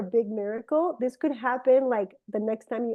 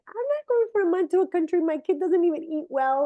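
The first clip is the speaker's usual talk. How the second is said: higher, fast indignant refusal; exasperated protest; 7 semitones higher than usual. Retold or imitated. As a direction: rattle it off, protesting the idea. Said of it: "I'm like".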